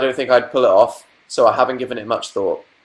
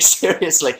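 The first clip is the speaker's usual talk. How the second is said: usual speaking voice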